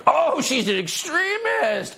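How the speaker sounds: high-pitched hysterical mimicry of critics; shrill-pitched, slow mocking outcry; mock scream. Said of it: "Scream the people who support".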